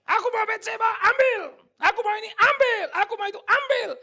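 very high, loud, bossy shouts of take it; a spoiled king grabbing whatever he wants; greedy king demanding. Acted. Preacher voicing greedy King David. (raja serakah berteriak memerintah)

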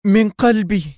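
high and loud, resolute; woman answering the men with conviction; very firm. A narrator's reading. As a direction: declare it with hand on heart. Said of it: "في حزم شديد".

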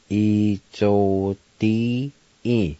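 E Z O D E drawn out slowly; slow careful pronunciation. Shown as a demonstration. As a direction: teach E Z O D E slowly to learners. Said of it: "It's pronounced like this".

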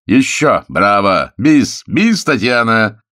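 loud, high bravo cries; cheering. A narrator's reading. Guests cheer Tatiana after her romance, loud. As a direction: cheer the singer on.

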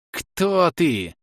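high, harsh hiss; hissing witch. A narrator's reading. Witch questioning her attacker, high hissing. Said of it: "прошипела ведьма".

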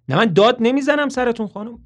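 loud, high shouted denial; Toby shouting down the phone at the mother. Acted. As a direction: yell that you are not yelling. Shouting while denying shouting.

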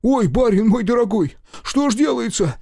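high, loud fussing servant voice; wailing old servant. A narrator's reading. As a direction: lament like a flustered old servant. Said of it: "причитал Захар".

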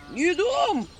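high creaky refusal; creaky house spirit. Acted. House spirit creakily refusing the imps. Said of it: "заскрипел он".

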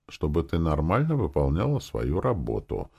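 ordinary delivery

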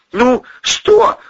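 high, loud, rattled question; edgy, very high-pitched query; touch of hysteria. A narrator's reading. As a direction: ask with hysteria creeping in. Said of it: "с оттенком истерики поинтересовался".

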